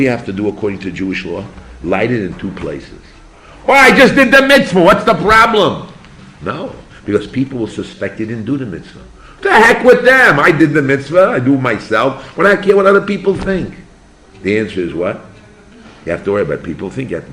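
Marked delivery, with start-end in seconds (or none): 3.7-5.9 high, loud protest; indignant objection. Acted. An objector protests to the teacher, indignant and loud. 9.4-13.7 loud, high-pitched defiance; defiant dismissal. Acted. An objector dismisses what people think, loud and defiant.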